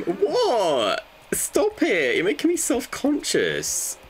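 high-pitched, flustered protest; self-conscious protest. Real, not acted. Streamer teased by viewers, high self-conscious protest. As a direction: squeal it in embarrassed protest. Teased about how they look.

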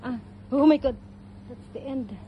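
ordinary delivery

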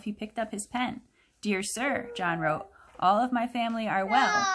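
normal talking voice

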